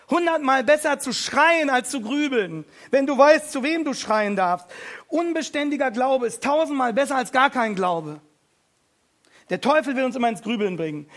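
laut, höher, mit voller Wucht predigen; lautstarke Predigt. Acted. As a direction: die Gemeinde zum Schreien aufrufen. Preaching at full force. (feurige Predigt mit voller Kraft)

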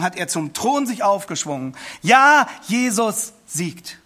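slow, loud, declaimed verse; young man proclaiming the hymn verse to the crowd; mighty proclamation. Retold or imitated. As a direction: call the verse out over a crowd. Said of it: "mit gewaltiger Stimme rief er".

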